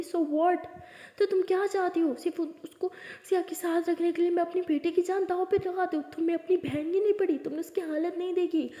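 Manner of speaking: loud, high, heated scolding; angry outburst. A narrator's reading. Mother scolds elder daughter, loud and angry. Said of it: "आप please शांत हो जाओ".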